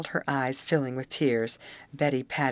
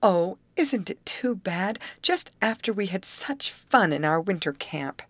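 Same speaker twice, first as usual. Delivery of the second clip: dismayed exclamation; 6 semitones higher than usual. A narrator's reading. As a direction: sigh it out, pitched up and sorry. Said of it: "exclaimed Grace".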